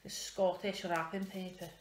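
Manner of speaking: slow, amateur Scottish accent; not-very-good Scottish accent. Shown as a demonstration. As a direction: try out a Scottish accent, drawing the words out. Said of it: "not very good at doing the accent".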